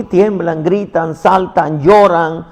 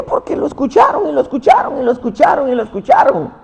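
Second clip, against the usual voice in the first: high, insistent repetition building the point; preacher driving the words home up high; rising fervor; 9 semitones higher, faster than usual. Acted. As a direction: hammer the phrase again and again, pitched up.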